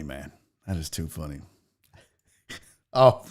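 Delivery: ordinary delivery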